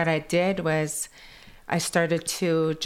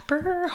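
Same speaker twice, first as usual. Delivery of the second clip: high "Brr" of cold; a high shivering "Brr"; 13 semitones higher than usual. Retold or imitated. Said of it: "really cold".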